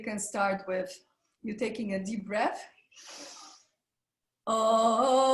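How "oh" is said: held vowel oh for warm-up; sustained oh. Shown as a demonstration. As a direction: sing out a steady oh. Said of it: "on the sound of O".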